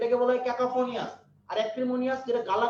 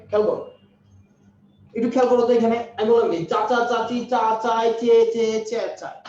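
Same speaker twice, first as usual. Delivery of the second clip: loud teacher; 8 dB louder than usual. Real, not acted. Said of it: "teacher calls for attention".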